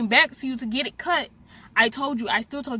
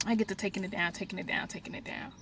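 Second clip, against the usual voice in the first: fading to silence; 3 semitones lower, 11 dB quieter, faster than usual. Retold or imitated. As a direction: repeat it softer and softer until it fades to a breath. Fading repetition while unbraiding.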